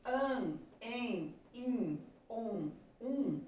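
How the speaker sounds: "an, en, in, on, um" as isolated nasal vowels; "an, en, in, on, um" recited one by one with pauses; nasal vowel sounds. Shown as a demonstration. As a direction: sound out each nasal in "an, en, in, on, um" separately. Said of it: "Toda vez que vocês tiverem o som".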